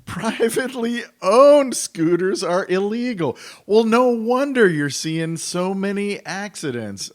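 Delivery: high, loud disbelieving outburst; incredulous. Real, not acted. Host reacting to a news clip on illegal scooters, incredulous. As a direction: repeat it as if you can't believe it. Repeats clip's claim in disbelief.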